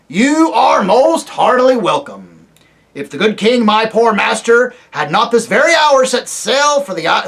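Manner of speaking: put-on high, loud querulous old dwarf; deaf old dwarf. Deaf dwarf to the owl, loud querulous old man. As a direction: bark it like an old man hard of hearing.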